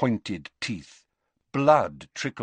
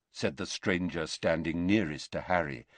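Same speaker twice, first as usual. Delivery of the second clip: usual speaking voice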